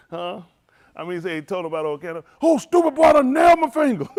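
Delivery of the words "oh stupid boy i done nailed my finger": high, loud mock cry of pain; retold pained yell. Retelling a man who nailed his finger, with a loud high mock yelp. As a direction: bellow it like you just hit your finger.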